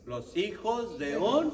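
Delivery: slow teacher's cue, voice lifted on "un"; leading prompt. Real, not acted. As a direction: stretch "un" and leave it hanging for the answer. Teacher prompts reader to complete answer.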